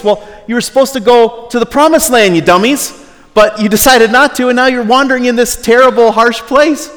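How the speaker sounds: high, loud exasperated scolding; exasperated retort. Acted. Preacher scolding the grumbling Israelites, loud exasperated yell. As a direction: yell back at them in mock frustration.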